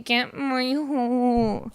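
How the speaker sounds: drawn-out mimicry of a friend's phrase; mocking repeat. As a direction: parrot it back very slowly and teasingly. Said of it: "You need to stop saying".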